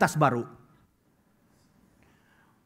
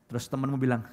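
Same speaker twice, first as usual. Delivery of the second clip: usual speaking voice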